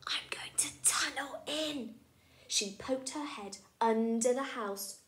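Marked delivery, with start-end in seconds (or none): 0.1-1.9 sneaky whisper; whispered plan. Acted. Rashida sharing her tunnel plan, in a whisper. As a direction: whisper it like a secret.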